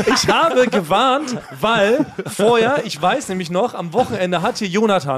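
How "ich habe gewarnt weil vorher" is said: laughing defence. Real, not acted. [name] laughing as he defends himself to the hosts. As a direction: protest slowly while laughing.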